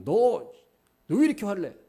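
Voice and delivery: a hammed-up rebuke stressing 왜; mock angry scolding. Imagined God snapping at Jonah, loud and high, stressing 왜. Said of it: "감정을 억누르시는 게 아니라".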